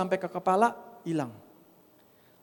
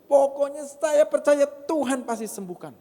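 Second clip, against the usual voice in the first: higher, insistent declaration; stubborn faith; 11 semitones higher, 4 dB louder than usual. Retold or imitated. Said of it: "saya bilang gitu". (keyakinan ngotot)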